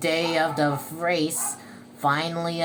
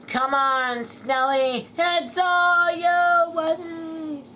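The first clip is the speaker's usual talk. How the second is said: high, loud cheer, stretched at the end; 11 semitones higher, 6 dB louder, slower than usual. Acted. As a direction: urge your racer home. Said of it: "Squidward cheered".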